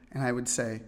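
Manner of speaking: ordinary talk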